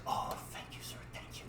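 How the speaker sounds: very soft whispered thanks; hushed, breath-only imitated gratitude; grateful whisper. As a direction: whisper it in humbled relief. Humbled boy thanks manager.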